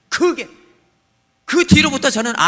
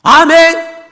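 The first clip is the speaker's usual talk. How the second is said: shouted amen, higher; loud amen; 7 semitones higher, 13 dB louder, much slower than usual. Retold or imitated. Believer shouting amen to God, loud. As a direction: bellow amen.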